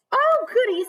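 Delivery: high, loud delighted granny. Acted. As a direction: squeal with glee. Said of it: "said Granny".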